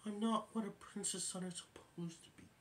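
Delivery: soft breathy whisper; Bambi's sad whisper. Retold or imitated. Bambi realizing he missed the goodbye, whispered. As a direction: whisper it, disappointed. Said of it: "and says".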